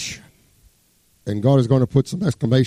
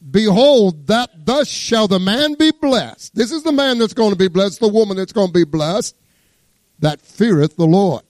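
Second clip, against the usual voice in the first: scripture reading; 7 semitones higher than usual. Acted. Reads scripture aloud.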